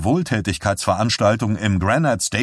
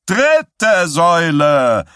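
higher, louder, slow bellow; 8 semitones higher, 6 dB louder, much slower than usual. A narrator's reading. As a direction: roar out the number. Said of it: "brüllte Stilson".

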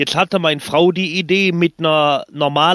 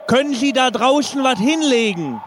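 mock panic; 8 semitones higher than usual. Parachutist prank call continues.

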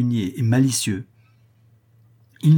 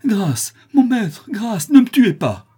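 put-on child's loud, high plea for mercy; crying out; 10 semitones higher, 7 dB louder, slower than usual. A narrator's reading. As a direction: beg desperately, pitched high and loud. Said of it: "l'enfant se mit à crier".

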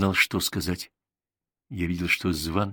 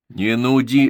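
loud curt retort; snapping back; 4 semitones higher, 8 dB louder, slower than usual. A narrator's reading. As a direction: snap it back sharply. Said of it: "огрызнулся я". (резко огрызнуться)

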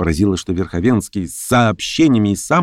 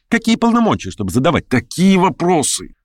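indignant challenge; 10 semitones higher than usual. Acted. Lame man attacking in acted quarrel.

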